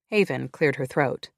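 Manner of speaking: ordinary delivery, nothing marked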